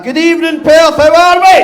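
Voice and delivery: high, loud crowd-rousing shout. Shown as a demonstration. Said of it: "you can do better than that".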